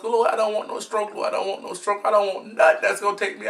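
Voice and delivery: hushed plea to the Lord; half-joking quiet prayer; softly begging the Lord; mock prayer. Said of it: "prayer to God".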